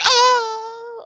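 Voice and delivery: very high, held comic shriek; mock scream. Host imitating Buffy getting slapped, mock scream. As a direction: squeal like someone slapped, hammed-up.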